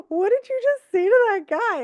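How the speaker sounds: high, loud amazed question; stunned disbelief at her; loud high astonished question; astonished crew. Retold or imitated.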